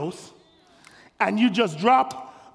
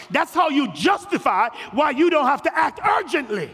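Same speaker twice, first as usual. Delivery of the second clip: high, shouted, driving sermon lines; full-force preaching; 6 semitones higher than usual. Acted. Pastor warning the church against excuses, shouting. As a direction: hammer the point home to the pews.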